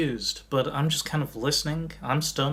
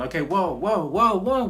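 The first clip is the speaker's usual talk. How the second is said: rising, louder string of whoas; calling out; 6 semitones higher, 5 dB louder than usual. Retold or imitated. Magician calling out to everyone chiming in, urgent calming call. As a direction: climb higher to halt a crowd. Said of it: "I'm literally calling out to everyone".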